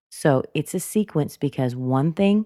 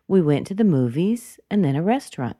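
"movies" lifted mid-sentence; rising intonation; 2 semitones higher than usual. Shown as a demonstration. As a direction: raise "movies", then settle down on "restaurant". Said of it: "why would I make a rise in intonation".

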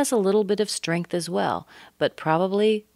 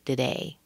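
quieter "today", weak "to" and fuller "day"; stress on DAY; 4 semitones lower, 5 dB quieter than usual. Shown as a demonstration. Teacher checks the stress, on DAY. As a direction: land on the second syllable of "today". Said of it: "you're stressing the day".

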